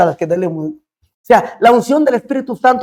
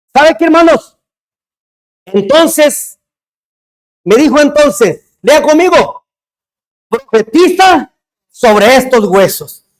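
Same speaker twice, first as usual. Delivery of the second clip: louder, higher, driving sermon delivery; full-force preaching; 6 semitones higher, 4 dB louder than usual. Acted. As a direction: preach it hard to the congregation.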